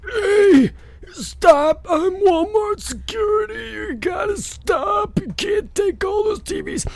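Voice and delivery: put-on very high, straining old-man voice; old security guard. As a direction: cry out like a feeble, protesting elderly guard. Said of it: "eighty-five-year-old man in a wheelchair".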